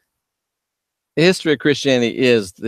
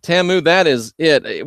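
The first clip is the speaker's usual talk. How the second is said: ordinary talk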